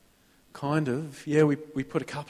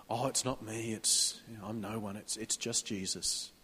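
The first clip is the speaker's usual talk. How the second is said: hushed, much softer falsely modest reply; mock humble; 5 semitones lower, 14 dB quieter than usual. Churchgoer brushing off a compliment, mock humble and hushed. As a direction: shrink away from the praise, voice dropping.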